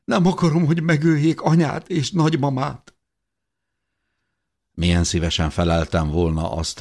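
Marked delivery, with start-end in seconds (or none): 0.0-3.0 much higher, pleading through tears; tearful plea. Acted. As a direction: beg desperately, voice breaking. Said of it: "rebegte sírva elcsukló hangon". (sírós, kétségbeesett könyörgés)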